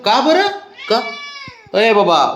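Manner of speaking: high sing-song coaxing voice for a pet; pet baby talk. Acted. Owner coaxing sulking dog Babdya, high sing-song baby talk.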